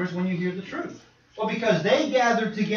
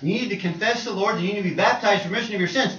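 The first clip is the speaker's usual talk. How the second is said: normal talking voice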